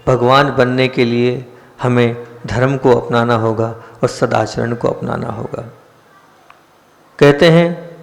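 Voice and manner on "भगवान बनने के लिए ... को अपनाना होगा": ordinary delivery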